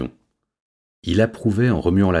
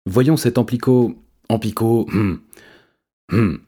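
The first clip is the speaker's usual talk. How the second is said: halting, higher-pitched attempt; hesitant false starts; stumbling over a word; 4 semitones higher than usual. A narrator's reading. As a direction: grope for the word, then give up with hums. Cat stumbles over a long word.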